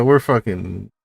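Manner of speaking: ordinary talk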